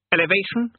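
high, rising puzzled question; startled demon king. A narrator's reading. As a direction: echo it in high-pitched surprise. Startled king queries the word.

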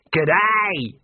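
a bandit hailing his victim cheerfully; cheerful shout. A narrator's reading. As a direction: greet him like a mocking old friend. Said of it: "he shouted cheerfully".

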